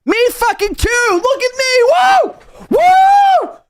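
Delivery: hammed-up excitement; mock giddy shout. Host mocks streamer's joy with giddy shrieks. Mocking her new mic freedom.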